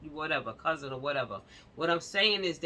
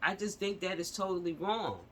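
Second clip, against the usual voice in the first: usual speaking voice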